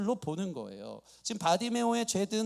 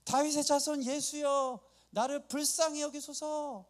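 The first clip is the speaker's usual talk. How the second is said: high-pitched, sustained plea for mercy; imitated crying out; 6 semitones higher than usual. Blind Bartimaeus calls to passing Jesus, imitated high cry. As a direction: call out as the blind beggar, pitched up. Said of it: "갑자기 소리를 엄청 크게 지른 거예요".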